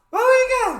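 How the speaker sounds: high, very loud launch cry; hyped shout. Real, not acted. Host launching into the clip, hyped high shout. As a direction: yell it out to kick things off.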